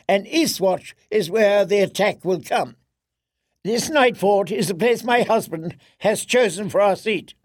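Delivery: high, imperious royal voice; haughty queen voice. Acted. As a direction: look down on everyone as queen.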